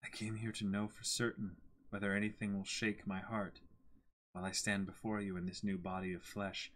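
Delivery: soft, cold and distant; cold Griffith voice. Acted. Reading Griffith's manga lines to his cohost, cold and soft.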